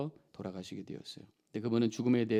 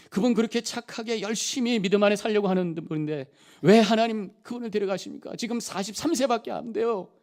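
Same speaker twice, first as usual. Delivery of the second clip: pleading cry to God, pitched much higher and louder; lamenting prayer; 9 semitones higher, 5 dB louder than usual. Retold or imitated. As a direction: cry out to God, asking why, in grief. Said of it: "하나님께 탄식 기도했습니다". (높고 큰 목소리로 하나님께 탄식하며 외치는 기도)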